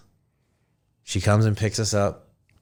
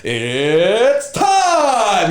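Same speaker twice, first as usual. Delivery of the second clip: loud, high ring announcer boom; Bruce Buffer call; 12 semitones higher, 4 dB louder than usual. Retold or imitated. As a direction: announce it to a full arena. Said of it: "He says".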